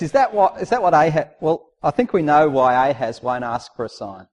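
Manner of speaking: usual speaking voice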